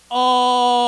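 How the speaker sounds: loud, long-held ALL; drawn-out emphasis. Real, not acted. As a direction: hold "all" out loudly on one note. Comic emphasis on distance driven.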